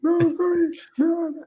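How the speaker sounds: high, loud sobbing pleas, voice cracking into a cry; bawling. Retold or imitated. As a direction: wail it out like a kid begging his mum. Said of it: "Proper, like, proper bawling".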